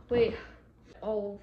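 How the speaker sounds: ordinary talk, nothing marked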